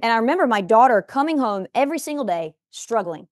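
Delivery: ordinary talk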